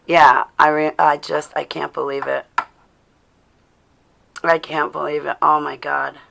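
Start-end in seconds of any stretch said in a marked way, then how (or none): none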